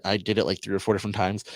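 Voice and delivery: usual speaking voice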